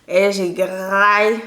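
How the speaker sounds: loud, long drawn-out "graille"; relishing emphasis. Real, not acted. Telling viewers how she feasted in France, relishing. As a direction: stretch "graille" out with gusto.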